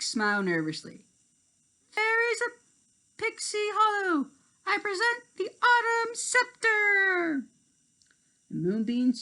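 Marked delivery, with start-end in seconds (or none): 1.9-7.4 very high proud proclamation; Tink announcing. Acted. Tink presenting the scepter to fairies, high proud voice.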